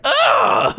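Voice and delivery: loud fed-up grunt; exasperated groan. Retold or imitated. Imitating Carolyn groaning back at the host, loud. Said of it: "she'd be like".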